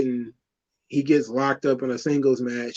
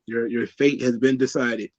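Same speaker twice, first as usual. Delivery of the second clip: usual speaking voice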